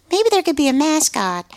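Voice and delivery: high, light voice for the nephew; playful high nephew impression; quoting nephew. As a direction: mimic a young nephew up high. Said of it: "my nephew was sort of like".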